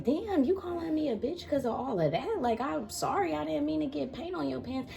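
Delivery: high, hurt little voice; my younger self. Retold or imitated. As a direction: sound small and wounded. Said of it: "And I was like".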